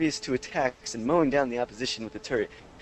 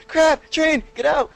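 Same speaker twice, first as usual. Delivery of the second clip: high, louder panicked squeal; mock panic; 14 semitones higher, 8 dB louder, slower than usual. Quoting the friend spotting the train, high mock yelp. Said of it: "His friend said".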